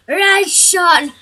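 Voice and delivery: ordinary talk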